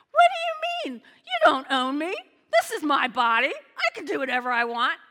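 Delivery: put-on loud protesting teenager, dragging out the words; defiant teen. Pastor voicing a rebellious teen defying the parents. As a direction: mimic a teen talking back. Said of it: "this teen says".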